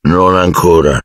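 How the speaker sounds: a touch of misery in a big voice; mournful. A narrator's reading. Hagrid to the kids, mildly mournful. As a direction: say it like it hurts to admit. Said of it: "disse Hagrid penosamente".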